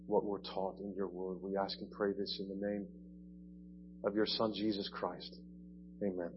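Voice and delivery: soft, subdued spoken prayer; hushed pastoral prayer before preaching. Real, not acted. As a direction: pray quietly to the Father. Leads a prayer.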